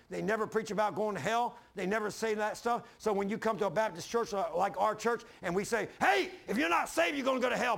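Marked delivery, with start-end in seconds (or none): 6.0-7.8 raised, louder preacher warning; mock warning shout. Street preacher yelling a warning at passersby, loud.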